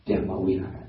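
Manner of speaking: Brahma Vihara said as 'Byama Vihara'; Burmese-style 'Byama' before Vihara; Burmese pronunciation. Shown as a demonstration. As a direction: turn the 'br' of Brahma into a gliding 'by'. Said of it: "in Burmese".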